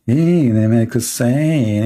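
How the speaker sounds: slow, drawn-out mimicry of a caller; mock caller. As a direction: stretch the vowels, mocking his way of talking. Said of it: "I will not allow him to speak like".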